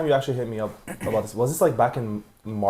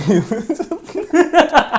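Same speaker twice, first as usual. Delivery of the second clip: high squealing laugh; 5 dB louder than usual. Real, not acted. Recalling embarrassing school move.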